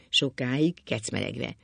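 usual speaking voice

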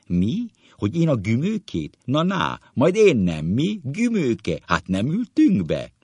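high, loud, delighted banter; excited, swooping high questions; boisterous recognition. Acted. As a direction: crow at your old schoolmate. Old schoolmate excitedly recognising the other.

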